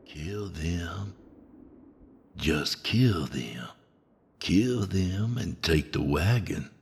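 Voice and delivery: soft, slow sinister whisper. A narrator's reading. Inner voice urges Tony to kill, whispered. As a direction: breathe it in his ear. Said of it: "A faint voice of the whisper".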